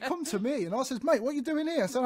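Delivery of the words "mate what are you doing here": high-pitched indignant question, acted out; retold exasperation. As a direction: mildly re-enact challenging someone. Retelling his row with banksman.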